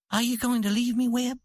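plain reading voice

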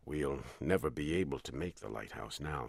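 low, fast, soft captain voice; low calm captain. A narrator's reading. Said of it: "He always spoke in a low voice and calmly".

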